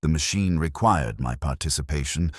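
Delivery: usual speaking voice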